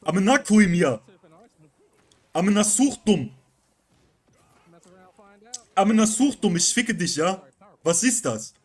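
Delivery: loud, high German Turkish swearing; German Turkish rage accent. Retold or imitated. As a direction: yell it like a raging German Turkish gamer. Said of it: "Turkish, uh, Kenneck accent".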